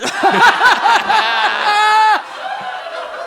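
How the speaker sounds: big high burst of laughter; loud laugh. Real, not acted. Loud laugh at dial joke.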